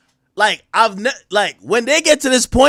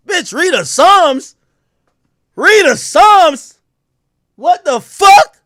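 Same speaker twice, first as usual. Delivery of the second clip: slow, higher, louder disbelief; incredulous outrage; 5 semitones higher, 3 dB louder, slower than usual. Real, not acted. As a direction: drag it out like you can't believe she said that. Outraged disbelief at her excuse.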